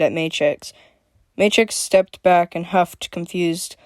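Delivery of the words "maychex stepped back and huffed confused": usual speaking voice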